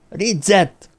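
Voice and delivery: high-pitched call to stop someone; shouted name. A narrator's reading. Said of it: "kiabálta".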